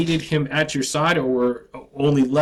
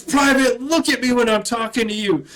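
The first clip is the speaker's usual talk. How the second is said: drill sergeant yell; 8 semitones higher than usual. Retold or imitated. Drill sergeant yelling at a recruit who looked down. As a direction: bark it like a drill instructor.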